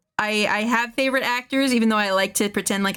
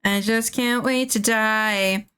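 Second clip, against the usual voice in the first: slow drawl; much slower than usual. Shown as a demonstration. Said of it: "repeated in higher tone".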